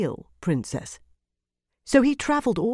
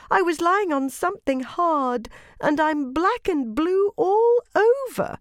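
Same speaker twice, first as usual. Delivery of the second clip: cartoon princess; 10 semitones higher than usual. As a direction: moan like a delicate princess. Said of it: "said the princess".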